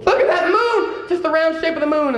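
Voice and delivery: high, laughing exclamation of wonder; amazed delight. Retold or imitated. Formerly blind man pointing out the moon, amazed. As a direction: exclaim it high, marveling like a child.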